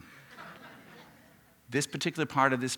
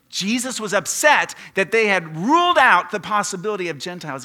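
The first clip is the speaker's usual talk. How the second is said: loud, high-pitched emphatic outburst; impassioned preaching; 8 semitones higher, 11 dB louder than usual. Real, not acted. Preacher explaining why Jesus was upset, loud and impassioned. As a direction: drive it out at full preaching force.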